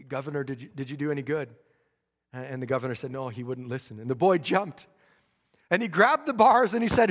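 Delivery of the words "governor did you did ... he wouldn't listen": hushed exchange. Retold or imitated. Quiet exchange guard and governor.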